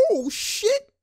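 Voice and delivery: very high, soft squeal of shock; shocked onlooker. Retold or imitated. Streamer voices the guy in the back, high hushed shock. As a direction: squeak it like a stunned bystander. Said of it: "he's like".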